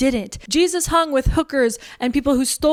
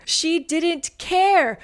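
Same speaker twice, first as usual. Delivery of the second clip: slowed-down repeat; 6 semitones higher, much slower than usual. Shown as a demonstration. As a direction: drag out She didn't care very slowly. Repeats line, second slowed.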